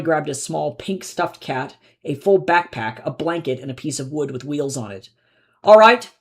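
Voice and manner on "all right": a loud, bright announcement; announcing. A narrator's reading. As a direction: call it out to the room. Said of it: "he announced".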